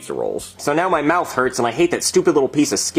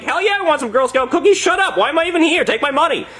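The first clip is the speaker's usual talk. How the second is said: high-pitched, hammed-up enthusiasm; overexcited eagerness; 13 semitones higher than usual. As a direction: blurt it out, desperate to buy. Acted eager reply at the door.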